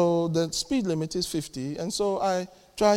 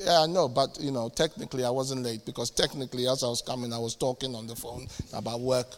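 mumbled excuse; 7 semitones lower, 7 dB quieter than usual. Acted. Acted colleague excuses himself.

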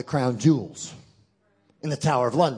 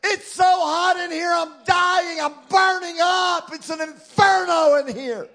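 hammed-up starving teen; 13 semitones higher, 7 dB louder than usual. Pastor imitating his hungry teenage son, loud mock wail. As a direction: ham up a hungry teenager's overdramatic complaint. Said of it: "He'd walk into a room that's a little bit hot".

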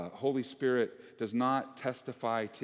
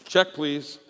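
mock bail-out; 4 semitones higher, 9 dB louder than usual. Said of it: "I'm going".

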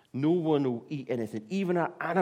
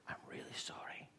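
a hushed, contrite whisper; whispered apology; 17 dB quieter than usual. Retold or imitated. Jonah praying to God from inside the fish, whispering. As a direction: whisper it, barely audible.

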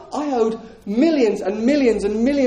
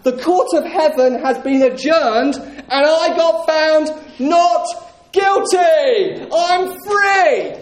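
higher and louder, ringing defiance; triumphant declaration; 6 semitones higher, 3 dB louder than usual. Acted. Believer answering the enemy's accusations, exultant and higher, louder. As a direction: throw the verdict back in the accuser's face. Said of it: "You can say".

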